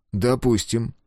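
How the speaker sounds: ordinary delivery, nothing marked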